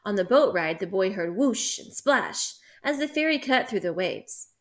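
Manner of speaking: ordinary delivery, nothing marked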